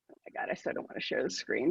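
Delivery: soft, breathless whisper to herself, fast; embarrassed mutter. Real, not acted. Sharing a photo she dreads showing, whispering.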